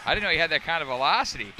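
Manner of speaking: fast, laughing tease. Real, not acted. Announcer teasing partner about slamming clipboard, laughing. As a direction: laugh through the line at your partner.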